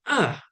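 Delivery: A falling sharply from high; fourth tone. Shown as a demonstration.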